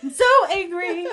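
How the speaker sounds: high, loud, half-laughing angry cry; hammed-up angry sob, high and loud; mock angry crying. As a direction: wail it out like an angry crier, mostly laughing. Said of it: "that angry cry where I'm like".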